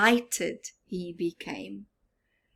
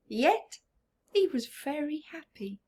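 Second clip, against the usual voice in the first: ordinary delivery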